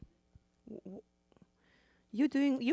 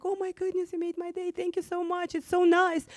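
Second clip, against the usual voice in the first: 1 thrilled thanks; 10 semitones higher, 6 dB louder than usual. Retold or imitated. Man thrilled his meal was paid.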